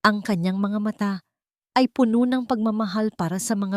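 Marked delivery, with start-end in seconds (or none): none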